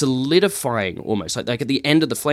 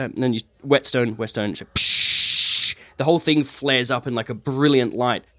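usual speaking voice

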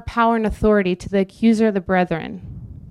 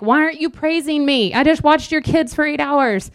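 higher pitched, whiny demand for praise; a put-upon helper fishing for thanks, higher; mock resentful babysitter; 6 semitones higher than usual. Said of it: "I'm like".

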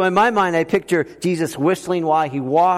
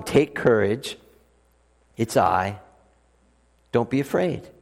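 soft, low, calm comfort; tender words to the frightened disciples, low and soft; gentle reassurance; 8 semitones lower, 4 dB quieter than usual. Retold or imitated. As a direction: settle scared men with quiet comfort.

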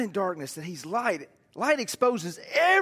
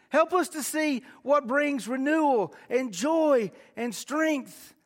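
higher and slow, pleading prayer to the Lord; fervent prayer; 7 semitones higher, slower than usual. Acted. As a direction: lift the words up to God in earnest prayer. Prays aloud to the Lord.

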